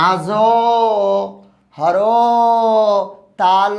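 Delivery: আজ, হর, তাল split into slow separate syllables. Shown as a demonstration. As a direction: break আজ হর তাল into its beats with long pauses. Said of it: "এটা জীবনও হবে না".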